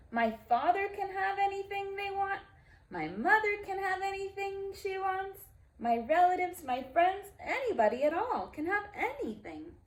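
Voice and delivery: high, eager and gleeful; bubbling over with the luck of it; thrilled young Sylvester, voice climbing high; gleeful donkey boy. Acted. Sylvester gleefully imagines his wishes.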